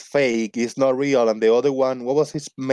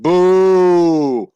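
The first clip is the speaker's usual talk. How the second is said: loud jokey boo at the drop in viewers; playful loud boo; mock boo; 5 semitones higher, 8 dB louder than usual. Real, not acted. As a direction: jeer loudly, half in fun. Host reacts to losing viewers.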